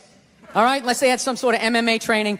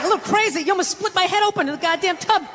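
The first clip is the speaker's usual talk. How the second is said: high-pitched incredulous outrage; retold exasperated protest; indignant retort; 6 semitones higher than usual. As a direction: throw the words back, pitch jumping up. His outraged retort in retold quarrel.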